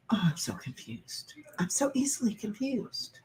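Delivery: breathy mock-despairing whisper; a hushed, melodramatic sigh of confusion. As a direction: whisper it like a lost soul. Mock despair after being confused.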